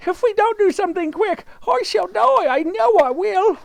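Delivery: wife wailing she will die; panicked Miss Twit. Acted.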